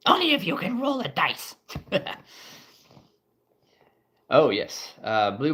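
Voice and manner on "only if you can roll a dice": very high squeaky goblin. Acted. Goblin teasing the players about dice, high squeak. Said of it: "The goblin's like".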